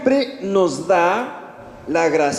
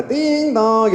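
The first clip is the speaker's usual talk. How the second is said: higher sing-song ding dong; imitated doorbell; 6 semitones higher than usual. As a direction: chime it like a doorbell. Someone rings the door. (timbre de puerta imitado, tono agudo)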